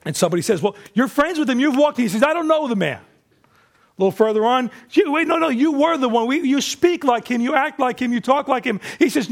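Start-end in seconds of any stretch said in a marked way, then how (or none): 0.6-2.0 high-pitched confrontation; accusing. Acted. Bystander confronts Peter after the arrest, accusing. 2.2-3.0 high, hurried-in protest; defensive denial. Acted. Peter answers his accuser, defensive. Peter denies Jesus when confronted. 4.9-8.8 high, piled-up accusations; insistent accusing. Acted. Accusers confront Peter a second time, insistent.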